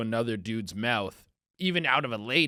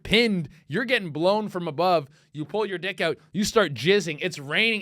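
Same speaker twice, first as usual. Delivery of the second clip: usual speaking voice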